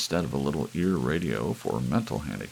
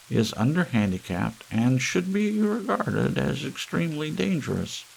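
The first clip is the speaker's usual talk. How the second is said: higher squawking announcer voice; grackle squawk; 8 semitones higher, 4 dB louder than usual. Acted. Ballerina reading the bulletin in a higher squawk. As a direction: squawk it out, deliberately ugly. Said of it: "she said in a grackle squawk".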